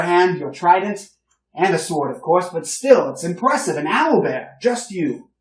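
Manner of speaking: put-on older gentleman greeting the heroes, loud and hearty; boisterous older gentleman. Old gentleman gushes over hero.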